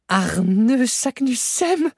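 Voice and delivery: very slow, high drawn-out proclamation of the name; triumphant cry. A narrator's reading. Uncle reads the runic name aloud, slow triumphant cry. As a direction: savour each syllable in triumph. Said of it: "s'écria mon oncle".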